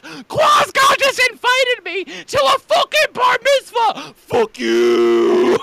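high, very loud hysterical shout; frantic scream. Retold or imitated. Host acting out his own wild news delivery, screaming. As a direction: scream it like a madman. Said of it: "and be like".

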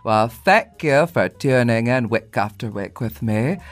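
slow, grand theatrical character voice for a fan; drawn-out, lofty put-on voice with elaborate vowels; his Schitt's Creek character. Greeting the host's friend Ashley as the requested character, grand theatrical voice. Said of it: "that character".

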